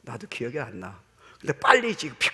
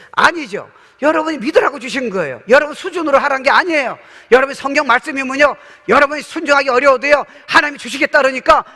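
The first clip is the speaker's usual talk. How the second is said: loud, high, driving sermon delivery; full-force preaching; 10 semitones higher, 13 dB louder than usual. Acted. Pastor urging the congregation to believe, preaching at full force. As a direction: preach it hard to the back row.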